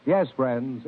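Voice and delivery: ordinary talk, nothing marked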